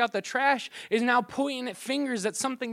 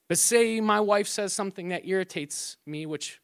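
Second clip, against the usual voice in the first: ordinary delivery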